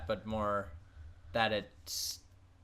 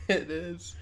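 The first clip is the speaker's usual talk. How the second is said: laughing; 9 semitones higher, 4 dB louder than usual. Real, not acted. Laughing at creepy whisper.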